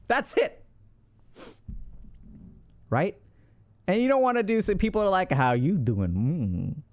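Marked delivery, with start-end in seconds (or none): 5.3-6.8 drawn-out, low smarmy "How you doing?"; slimy pickup line. Retold or imitated. Mocking a smarmy partygoer's opener, slow and low. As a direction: stretch it slow like a cheesy flirt. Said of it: "Don't do any of that crap".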